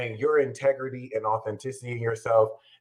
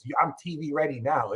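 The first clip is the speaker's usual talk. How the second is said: usual speaking voice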